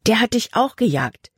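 höher und lauter, aufgeregt rufen; aufgeregter Ausruf. Acted. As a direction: aufgeregt hinausrufen. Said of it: "rief Libby aus". (Libby warnt Jordan, aufgeregt rufend)

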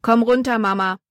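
calling out. A narrator's reading. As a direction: call to a parent to climb down. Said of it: "rief Jordan in den Wagen".

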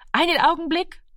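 high, singing call back; mother's high-pitched holding reply. A narrator's reading. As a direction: call out high to someone at the door. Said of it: "rief die Mutter".

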